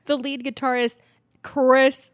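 mock DJ build-up to a loud name; radio announcer. Radio host naming the groom on air, punched announcer voice. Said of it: "all of a sudden on the radio".

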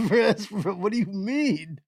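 ordinary talk

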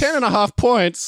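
can't believe the score, drawn out up high; disbelieving. Real, not acted. Told his prediction score to the host, slow and high in disbelief.

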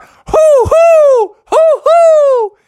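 loud falsetto hoots; high, whooping hoo-hoo; screamed hooting. A narrator's reading. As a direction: scream it out like an owl. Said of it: "screaming out".

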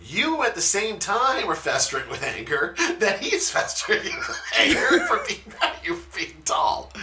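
laughing through the words. Real, not acted. Telling a friend about the tall guy, laughing. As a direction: giggle while explaining.

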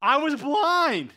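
insistent, stretched-out acted exclamation; emphatic exclamation. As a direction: proclaim it slowly and forcefully as testimony. Acted testimony of the healed man.

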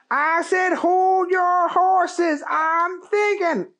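high, loud, very slow drawl; Keith voice. Acted. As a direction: drag out hold your horses like a cowboy.

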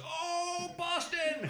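very high, drawn-out exclamation; hammed-up delight at hearing the name; overjoyed cop. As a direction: imitate a thrilled Irish cop. Acted cop delighted by Boston.

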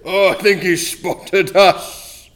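normal talking voice

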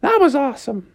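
put-on high, squeaky kid voice; pleased cartoon sister; thrilled little sister. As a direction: crow in a little-girl falsetto. Sister after sled ride.

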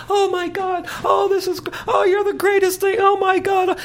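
very high falsetto gushing; mock ecstatic woman. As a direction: squeal praise in an exaggerated falsetto. Imitates woman gushing during scene.

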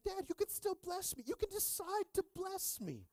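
very high mock child voice; pleading son. Son begging dad to bless him, high pleading. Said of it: "He's like".

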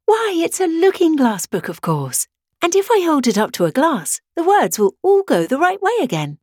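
a sudden happy idea; Alice realising it's a looking-glass book; bright realisation. Acted.

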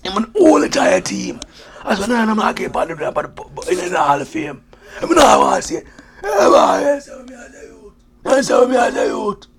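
put-on comic Jamaican accent of an aging ex-player; old Jamaican man voice. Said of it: "the old Jamaican man voice".

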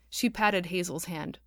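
plain reading voice

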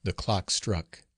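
ordinary delivery, nothing marked

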